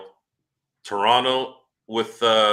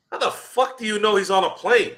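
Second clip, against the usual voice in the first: much higher, disbelieving outburst; incredulous; 11 semitones higher than usual. Retold or imitated. Retelling his reaction to the plane rumour, incredulous. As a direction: squeak out the disbelief. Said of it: "And I'm like".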